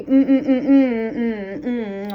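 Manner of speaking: mock annoyed girlfriend humming; Pam's mm. Pam humming at Quincy, mock annoyed. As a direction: hum it like an irritated girlfriend. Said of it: "So Pam be".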